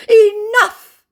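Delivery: very high, loud, drawn-out cry; hammed-up furious outburst; mock incensed. Scammer fed up with being insulted, mock incensed. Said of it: "declares my incensed scammer".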